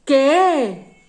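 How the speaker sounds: "¿Qué?" called out loud and high; loud, high emphatic "¿Qué?" showing its accent; stressed question word. Shown as a demonstration. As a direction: punch "¿Qué?" like announcing it to the class. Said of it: "deben de llevar acento".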